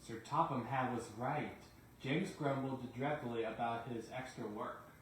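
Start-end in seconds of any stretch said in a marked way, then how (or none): none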